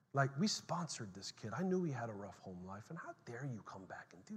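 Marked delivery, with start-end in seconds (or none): none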